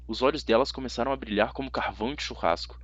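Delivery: ordinary delivery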